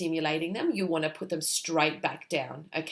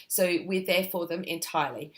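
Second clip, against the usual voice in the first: ordinary talk, nothing marked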